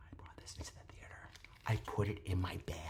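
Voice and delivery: hushed confession whisper; secret whisper. Real, not acted. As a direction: keep it low so nobody hears. Confessing contraband candy.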